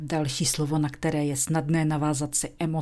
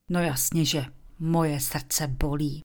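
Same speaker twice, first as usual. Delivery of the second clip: normal talking voice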